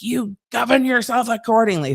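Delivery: prim and cutting; shady lawyer letter. Retold or imitated. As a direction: read it like a passive-aggressive warning. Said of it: "it's shady".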